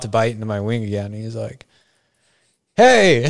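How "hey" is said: loud high hey; calling out. Retold or imitated. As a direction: grab her attention from nearby. Stranger calls out again.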